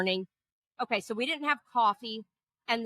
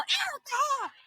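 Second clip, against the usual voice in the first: high mock yell of her name; retold yell; slower than usual. To her sleeping friend, a high mock yell. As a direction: shout the name high like waking from a nightmare. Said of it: "So I yell".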